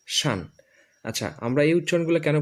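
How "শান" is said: শান with an open vowel, "shan", falling; new pronunciation. Shown as a demonstration. Teacher models the new ending, শান as falling "shan". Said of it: "এখন আমরা উচ্চারণ করব".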